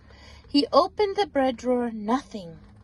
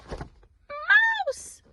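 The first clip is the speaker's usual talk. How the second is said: far higher gleeful exclamation; excited cry; 3 dB louder than usual. A narrator's reading. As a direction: shout the surprise to the child. Mouse revealed again.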